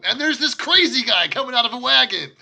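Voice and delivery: loud, high, laughing exclamation; mock excited outburst. GM mimics a wild random encounter.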